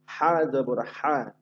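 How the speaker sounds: হা drawn out slowly with breath; slow letter drill. Shown as a demonstration. Teacher drills ha with fatha slowly. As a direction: stretch হা from the throat. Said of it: "এরপরে আছে হা হা".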